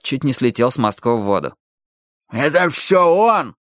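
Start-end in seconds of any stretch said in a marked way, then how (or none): 2.3-3.5 high, drawn-out protest; accusing cry. A narrator's reading. Henchmen shove and blame each other, crying out accusingly. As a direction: shift the blame in a long raised cry.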